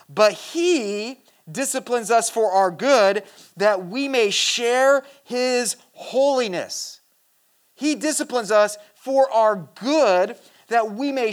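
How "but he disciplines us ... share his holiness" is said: drawn-out, weighty scripture reading; slow emphatic reading. Acted. Preacher reading scripture slowly to his congregation, emphatic. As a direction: lean on every word of the verse.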